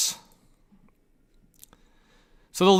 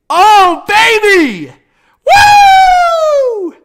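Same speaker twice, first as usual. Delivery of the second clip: much higher, loud celebratory shout; joyful whoop; 13 dB louder, much slower than usual. Real, not acted. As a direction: scream it out in triumph. Celebrating the trade.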